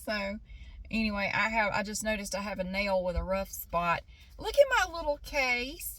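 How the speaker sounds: ordinary delivery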